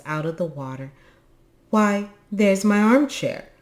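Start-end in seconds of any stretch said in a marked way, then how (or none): 1.7-3.5 loud, high exclamation; big creature's startled shout; indignant cry. A narrator's reading. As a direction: cry out on spotting your property. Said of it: "cried a big Himulin".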